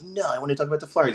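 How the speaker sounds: high, put-on whiny insisting voice; stubborn caller. Caller refusing to talk Eagles with the screener, high whiny insistence. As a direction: dig in like a petulant caller.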